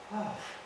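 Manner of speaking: soft sigh, letting the air out; imitated deflating sigh; sigh of release. Officer retells Justin calming down, soft exhaled sigh.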